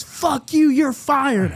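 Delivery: high, loud mock fury; retold angry yell. Boss firing employee over the phone, angry yell. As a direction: imitate a boss blowing up.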